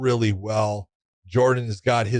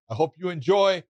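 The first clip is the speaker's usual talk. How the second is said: ordinary delivery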